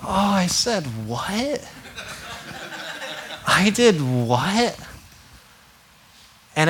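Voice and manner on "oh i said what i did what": slow, higher, laughing surprise; mock realization. Pastor acting his own dawning shock at his words. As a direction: act out stunned disbelief at yourself. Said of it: "it was like".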